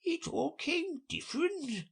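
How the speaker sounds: high, slow musing creature voice; turning it over slowly; thoughtful. A narrator's reading. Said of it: "repeated thoughtfully".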